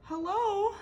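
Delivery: high, loud calling hello. Acted. Abel calling for missing Beatrice, loud and high. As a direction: call out searching. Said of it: "Abel called".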